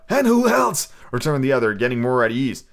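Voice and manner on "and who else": higher and louder question; demanding shout. Acted. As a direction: bark it at him. Retake of the line.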